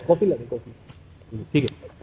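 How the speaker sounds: soft, low off-mic aside; quiet aside. Real, not acted. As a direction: say it low and away from the mic, to someone nearby. Off-mic aside ordering coffee.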